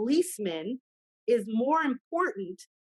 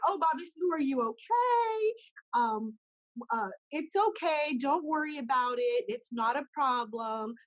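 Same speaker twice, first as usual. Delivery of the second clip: mock-soothing a child, pitched up; cooing comforter; 3 semitones higher than usual. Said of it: "everyone's gonna be like".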